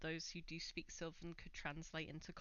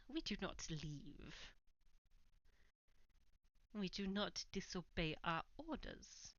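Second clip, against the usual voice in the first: put-on Welsh accent. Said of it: "mostly Welsh accent is tiring".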